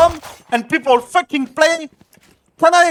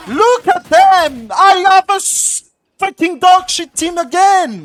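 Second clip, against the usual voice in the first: furious rant; 6 semitones higher, 5 dB louder than usual. Real, not acted. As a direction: blow up at your useless team. Raging at teammates mid-match.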